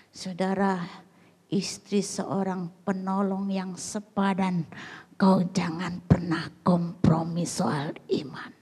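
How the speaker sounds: usual speaking voice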